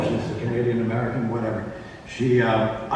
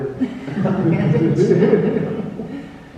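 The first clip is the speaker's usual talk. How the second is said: laugh; 8 semitones higher than usual. Real, not acted. Laughing at the bandage joke.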